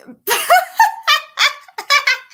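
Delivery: squeaky high giggle; high squeal laugh. Real, not acted. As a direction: break into a squeal. Laughing mid sentence.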